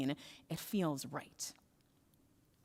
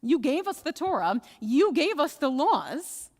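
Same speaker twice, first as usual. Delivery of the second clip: "You gave us" punched higher and louder; emphatic argument; 7 semitones higher, 6 dB louder, slower than usual. Acted. Rabbis reminding God who gave the Torah, emphatic on "You gave us". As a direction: hammer each "gave us", raised voice.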